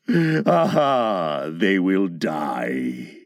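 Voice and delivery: very low, slow villainous relish; deep gloating pirate menace. A narrator's reading. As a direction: draw it out darkly, deep and slow. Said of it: "He burst into laughter".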